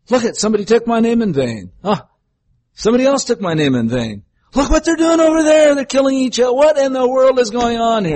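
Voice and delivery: mock angry God. Preacher mocking the idea of an angry God, high and exasperated. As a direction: ham up a frustrated, ranting God. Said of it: "God doesn't go around upset and angry and frustrated".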